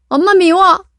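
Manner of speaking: high, loud tantrum cry; furious child. Acted. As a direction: scream it and storm off. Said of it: "화가 나서 밖으로 뛰어나간다".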